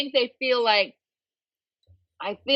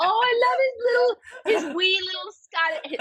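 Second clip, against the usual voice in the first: high, wailing coo over something cute; gushing adoration; 10 semitones higher than usual. Retold or imitated. Co-host gushing over the toddler's accent, high adoring wail. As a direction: squeal it like you're melting.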